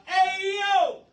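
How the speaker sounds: loud, high radio hype call; show opener shout. Acted. Host opening his radio show with a hype shout. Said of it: "Peace and blessings".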